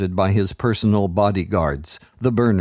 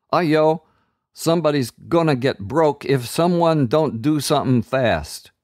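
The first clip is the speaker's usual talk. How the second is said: put-on higher, streetwise kid warning; urgent kid voice; 6 semitones higher than usual. A narrator's reading. Kid warning the others about danger, urgent.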